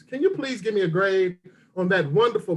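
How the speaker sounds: normal talking voice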